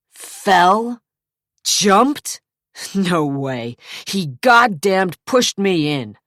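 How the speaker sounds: high, loud scorn then slow accusation; mock the words, then accuse; outraged denial. A narrator's reading. Zach to the cop, outraged denial.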